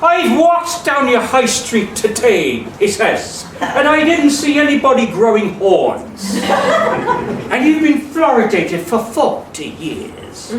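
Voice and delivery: mock Irish official, amused; Irish accent. Retelling the dental officer's visit in an Irish accent. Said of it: "I'm gonna attempt an Irish accent here".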